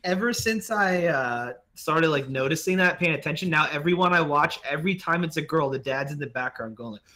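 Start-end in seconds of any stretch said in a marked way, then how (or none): none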